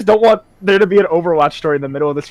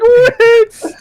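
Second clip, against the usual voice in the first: much higher, louder wail; crying shout; 6 dB louder than usual. Retold or imitated. Crying Life Weaver calling Winston, high wail. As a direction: sob out the name in anime style. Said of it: "He's like".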